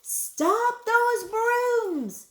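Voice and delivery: slow, high-pitched shouted command. A narrator's reading. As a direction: stretch it into a loud, frantic order. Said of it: "shouted the manager".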